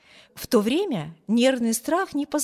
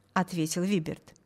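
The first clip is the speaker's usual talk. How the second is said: ordinary delivery, nothing marked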